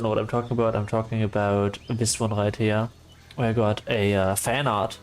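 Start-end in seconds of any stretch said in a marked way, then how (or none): none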